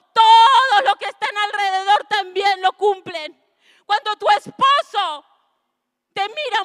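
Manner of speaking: predicación encendida, muy fuerte y más aguda, con quiebres de llanto; predicación a pleno. Acted. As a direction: clama a la congregación con fuerza y emoción. (la pastora exhorta a las mujeres, gritando con fervor)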